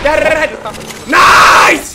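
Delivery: slow loud triumphant dead calls; hyped shout. Real, not acted. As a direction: shout the kill call. Celebrating a kill.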